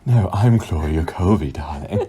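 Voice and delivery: slow, laughing posh lady voice; posh Claudia voice. Pretend. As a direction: play the grand dame, amused. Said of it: "We can't both be Claudia".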